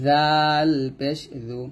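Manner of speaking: slow, drawn-out জাল with a thin z sound; thin consonant. Shown as a demonstration. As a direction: keep the জু light and thin. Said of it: "জালও পাতলা হবে". (পাতলা করে টেনে জাল পেশ জু)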